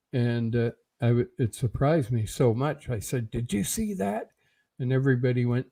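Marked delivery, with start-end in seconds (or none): 3.3-4.3 high, startled half-whisper; hushed surprise. Retold or imitated. Telling bandmates in the dark van, startled hushed blurt. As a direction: blurt it out in shock but keep it down. Shocked by head at window.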